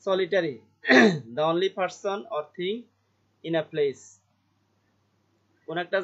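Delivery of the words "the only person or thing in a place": slow reading. Shown as a demonstration. Teacher reads definition slowly.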